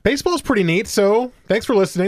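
loud, high, upbeat announcer voice; hyped podcast intro. Acted. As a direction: sell the show opening with energy. Hosts' hyped show opening.

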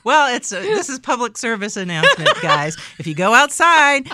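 loud, laughing mock announcement. Real, not acted. Mock PSA while laughing.

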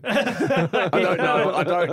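laughing through the words. Real, not acted. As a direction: say it while cracking up. Laughing denial.

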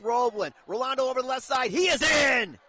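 fast, loud rising shout; touchdown call. Real, not acted. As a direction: shout it as he crosses. Two-point conversion call.

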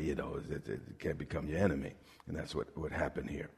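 soft preacher aside; hushed aside. Real, not acted. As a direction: drop it low and confidential. Drops voice for the point.

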